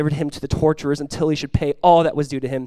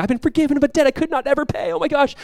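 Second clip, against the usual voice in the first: higher, quick giddy delight; mock joyful; 8 semitones higher than usual. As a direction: gush like a forgiven servant skipping away. Imagined joyful forgiven servant.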